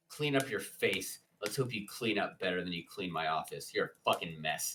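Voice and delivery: low, quick, dismissive boss; low rapid scolding, kept quiet; domineering boss. Acted. Voices the story's domineering boss.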